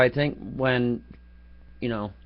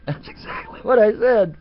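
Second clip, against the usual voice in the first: laughing; 9 semitones higher, 6 dB louder than usual. Real, not acted. Laughing while recounting.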